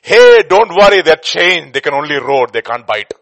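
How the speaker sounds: loud shout from the far side, starting high and easing down; voice calling across. Acted. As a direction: call reassurance across to a traveller. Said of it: "hears a voice from the other side".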